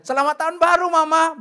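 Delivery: high, loud sing-song mock reply; son's reply. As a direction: echo the greeting just as brightly. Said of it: "Dia juga sama-sama bodohnya".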